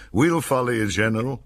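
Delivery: mild enthusiastic shout. A narrator's reading. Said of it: "some enthusiast actually shouted".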